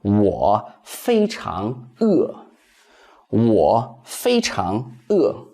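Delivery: slow, careful Mandarin for learners; slow teacher's demo; slow model sentence. Shown as a demonstration. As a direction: say it slowly, syllable by syllable, for students to copy. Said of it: "that would be".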